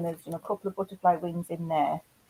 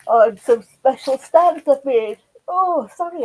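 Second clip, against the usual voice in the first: talking through a yawn, louder and higher; 6 semitones higher, 7 dB louder than usual. Real, not acted. Vlogger showing her craft stock apologises mid-sentence, yawning. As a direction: let a real yawn swallow the words. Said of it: "I'm yawning".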